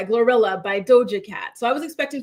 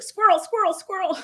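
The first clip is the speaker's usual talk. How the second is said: high, quick squirrel repeats; higher-pitched, playful distraction; distracted brain; 8 semitones higher than usual. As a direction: act out an easily distracted brain. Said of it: "my brain is very much like".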